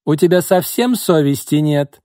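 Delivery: loud, high scornful yell; furious shout. A narrator's reading. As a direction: throw her out with contempt. Margo drives out the rival.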